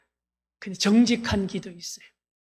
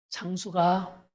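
normal talking voice

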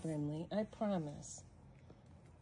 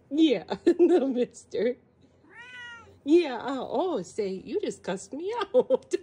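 laughing; 8 semitones higher, 12 dB louder than usual. Real, not acted. Laughing loudly at the kitten that cussed her out.

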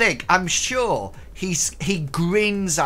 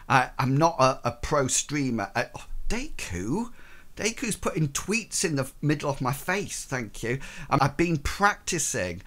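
ordinary talk, nothing marked

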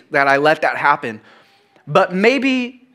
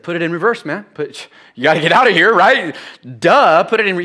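mock impatient; 3 semitones higher, 4 dB louder than usual. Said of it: "I said".

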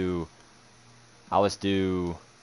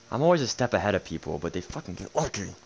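ordinary talk, nothing marked

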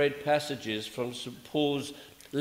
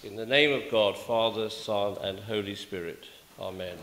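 invocation; 5 semitones lower than usual. Acted. Priest opening the sermon, intoned invocation.

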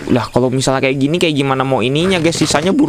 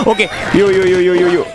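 excited coaxing; eager urging; 5 semitones higher than usual. Real, not acted. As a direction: cheer the shot along. Urging the bird on.

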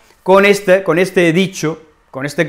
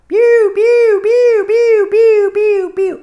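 piercing, much higher alarm beeps; alarm beeping; 4 dB louder, much slower than usual. Shown as a demonstration. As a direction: mimic a house alarm going off. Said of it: "la alarma pita". (imitando una alarma que pita, muy aguda)

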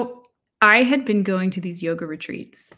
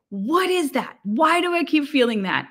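higher, puzzled pleading; pitched-up wondering; high searching question; 8 semitones higher than usual. Retold or imitated. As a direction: lift the voice, asking the sky. Retold inner questioning.

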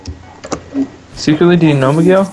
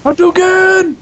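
Hadouken shout; slower than usual. Acted. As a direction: yell it like a special move. Game attack shout.

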